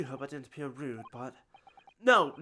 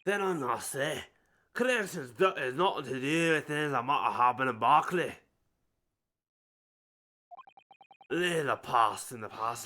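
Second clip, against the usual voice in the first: a hint of a stern Scots official; Scottish accent. Governor brushes off questions in a Scottish accent. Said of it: "And his Scottish accent".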